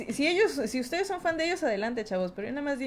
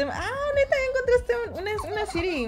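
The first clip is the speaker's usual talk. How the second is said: high, amazed "neta"; sudden impressed surprise; surprised; 9 semitones higher than usual. Real, not acted. Chat found a city. (sorpresa genuina, aguda)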